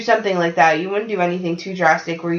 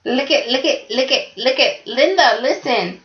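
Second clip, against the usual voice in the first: put-on higher playful kid voice in a sing-song; Listen Linda kid; 4 semitones higher than usual. Joining in the viral quote with a friend, higher sing-song kid voice. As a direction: chant it back like the viral kid. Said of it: "the Listen Linda kid".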